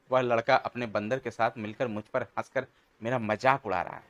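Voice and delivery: ordinary delivery, nothing marked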